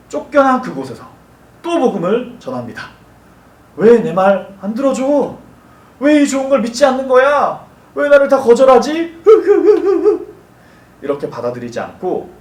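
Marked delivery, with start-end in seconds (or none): 3.8-9.2 high, loud whine of rejection; mock wounded complaint. Preacher voicing someone whose gospel is rejected, mock whining. 9.3-10.2 high, loud staccato fake boo-hoo; mock sobbing. Preacher playing someone rejected by all, mock sobbing. Acts crying after being rejected.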